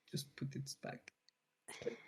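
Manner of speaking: breathy whispered aside; whispered under the breath. Real, not acted. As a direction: drop to a whisper. Whispered aside after laughing.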